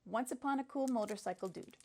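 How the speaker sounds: ordinary delivery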